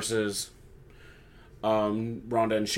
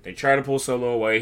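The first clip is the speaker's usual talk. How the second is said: normal talking voice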